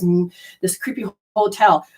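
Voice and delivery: ordinary talk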